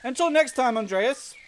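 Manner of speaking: lofty acted send-off; royal farewell. Royal figure's farewell read aloud.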